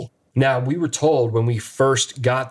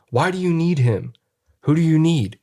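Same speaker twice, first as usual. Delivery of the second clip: higher-pitched, pressing questions; retold insistent questioning; 6 semitones higher than usual. Everyone questioning Clayton about who he needs, raised and insistent. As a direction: imitate someone demanding an answer. Retelling group questioning the entity.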